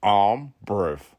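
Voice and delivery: "brave" said "breev", in a put-on voice; "brave" as "brev". Shown as a demonstration. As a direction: say "brave" like it is spelt B R E V. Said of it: "B R E V".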